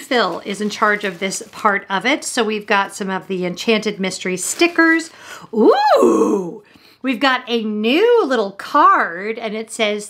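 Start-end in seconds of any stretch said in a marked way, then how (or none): 5.5-6.6 high, delighted ooh, pitched way up; a sudden squeal of discovery; spotting a treat in the box. Real, not acted. Spots something new in box.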